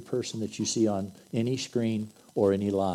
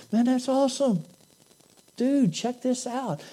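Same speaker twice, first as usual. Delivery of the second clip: stoked bro; 12 semitones higher than usual. Acted. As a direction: gush like a stoked surfer. Second buddy answering.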